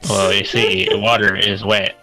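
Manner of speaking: ordinary talk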